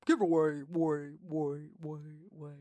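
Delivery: word repeats fading away; mock echo effect. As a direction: do your own echo on giveaway. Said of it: "some sound effects, like".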